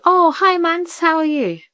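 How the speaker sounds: mock chirpy phone hello; cheery friend greeting.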